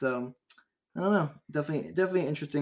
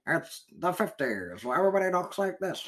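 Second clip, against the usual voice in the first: put-on old time radio voice; 4 semitones higher than usual. Shown as a demonstration. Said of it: "Do I sound like I'm on old time radio?".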